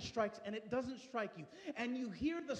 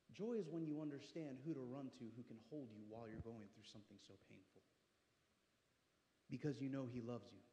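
soft, lower, gentle preaching; tender sermon; 10 semitones lower, 9 dB quieter than usual. Acted. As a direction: drop to a hush to comfort them.

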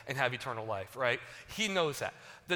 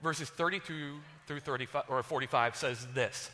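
ordinary talk

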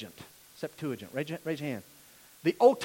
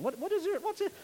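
mock teacher voice; 11 semitones higher, 6 dB quieter than usual. Teacher mocking student's name.

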